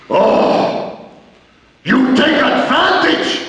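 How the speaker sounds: high furious shouting wrestler; angry accusation. Retold or imitated. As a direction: yell the accusation at the kid.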